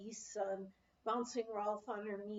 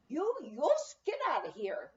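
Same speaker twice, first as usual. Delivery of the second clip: put-on higher, louder Norwegian-accented order to leave; a tiny Norwegian mother throwing the men out; Norwegian-accented mother telling the men to get out; strong Norwegian accent; 6 semitones higher, 5 dB louder than usual. A narrator's reading. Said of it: "she started in her strong Norwegian accent".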